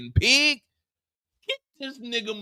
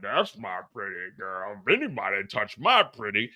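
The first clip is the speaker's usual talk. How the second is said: low muttered imitation of a doting owner; Miguel imitation; 12 semitones lower, 3 dB quieter than usual. Imitating Miguel guarding his pig, low and soft. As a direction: growl it low like a protective owner. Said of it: "Miguel talking about".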